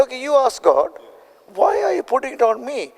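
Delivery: high, fast exasperated retort. Retold or imitated. Arguing with his wife over moving, exasperated. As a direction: toss the question back.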